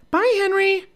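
put-on high, cheerful young girl; little girl voice. A narrator's reading. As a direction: wave goodbye in a high girl's voice. Narrator voices Annie.

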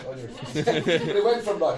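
normal talking voice

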